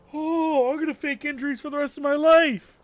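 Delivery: very high, loud squealing shout; hammed-up elation. Kid discovers faking gets attention, hammed-up elated shout. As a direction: cry out in mock life-changing delight.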